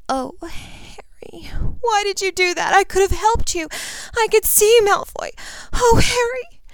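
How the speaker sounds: put-on high, breathy tearful girl's voice; young woman near sobbing; distraught girl. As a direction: plead through rising tears.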